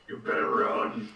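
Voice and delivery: slow, loud, rough low yell; mock scream. Player screaming a threat at goblins, rough loud yell. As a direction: roar it slowly as a threat. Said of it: "I just scream at them".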